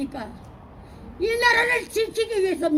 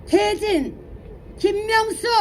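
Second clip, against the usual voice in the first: usual speaking voice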